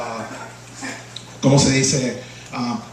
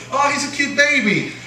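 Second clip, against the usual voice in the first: second doting aunt; 9 semitones higher than usual. Retold or imitated. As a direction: coo it like the other aunt. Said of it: "my other aunt would say".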